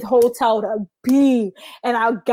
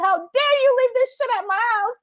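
mock outrage; 14 semitones higher than usual. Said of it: "I was so mad at her".

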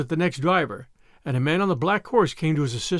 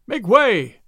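loud, raised warning call; men crying out; 4 semitones higher, 6 dB louder than usual. A narrator's reading. Men all about him, crying out loudly. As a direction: cry out to clear the road. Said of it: "cried the men all about him".

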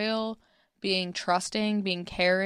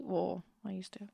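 whispered aside; 8 dB quieter than usual. Real, not acted. Wry aside about giving up turkey.

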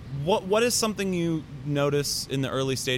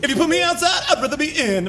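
cat voice; 13 semitones higher, 4 dB louder, faster than usual. Acted. Voicing the cat.

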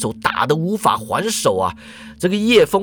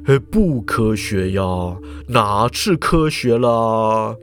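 put-on low, slow old professor explaining; 7 semitones lower, slower than usual. A narrator's reading. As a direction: lecture it low and unhurried. Professor shrugs.

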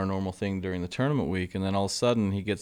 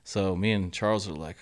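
ordinary talk

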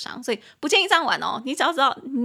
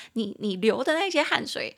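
usual speaking voice

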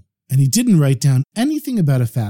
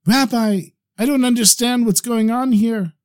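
higher-pitched, agitated appeal; upset; 8 semitones higher than usual. A narrator's reading. Farmer at the rabbi's door, upset. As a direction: call out for help, mildly distraught. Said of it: "he was very upset".

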